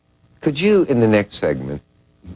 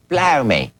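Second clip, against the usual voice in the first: lightly voiced Cockney blow me, higher; a hint of Cockney; 6 semitones higher than usual. Shown as a demonstration. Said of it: "Does blimey come from blow me".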